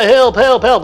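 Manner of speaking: imitated hollering. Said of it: "whoever's hollering".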